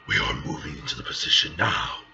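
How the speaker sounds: very low, put-on commander voice; commanding hero. Hero orders team into position, deep and commanding. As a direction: give the order in a deep battle voice.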